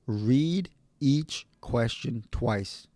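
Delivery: very slow, each word set apart; emphatic, dead serious. Real, not acted. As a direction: spell out "Read each question twice" word by word, deliberately. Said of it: "I'm, I'm serious".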